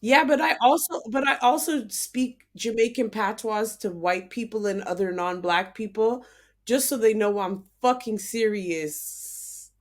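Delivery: ordinary delivery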